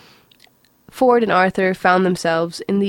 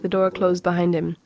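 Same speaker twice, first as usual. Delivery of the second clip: plain reading voice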